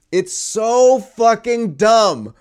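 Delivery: slow, drawn-out disbelief; exasperated. Real, not acted. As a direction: stretch it out in disbelief. Exasperated rant.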